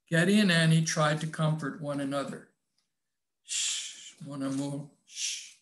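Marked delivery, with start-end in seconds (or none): 3.4-5.6 breathy hush, barely voiced; tender whispered hush to a lover. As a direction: soothe him with a whispered shh. Lovers comforting, hushing each other.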